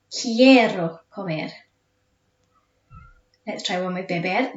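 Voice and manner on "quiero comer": slow, clear "Quiero comer" with a crisp Spanish "qu"; model pronunciation. Shown as a demonstration. Teacher models "Quiero comer" for the class to repeat. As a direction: stretch out "Quiero comer" for pupils to copy. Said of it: "repeat after me".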